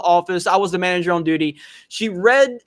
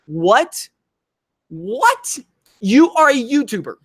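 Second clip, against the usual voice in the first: incredulous; 3 semitones higher, slower than usual. Retold or imitated. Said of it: "And I'm like".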